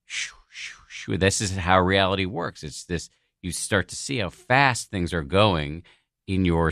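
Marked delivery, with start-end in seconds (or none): 0.1-1.2 soft voiceless whooshes, one after another; whooshing sounds. Retold or imitated. Meditator showing how fast reality works, whispered whooshes. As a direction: imitate things zipping past in quick puffs. Imitates senses switching rapidly.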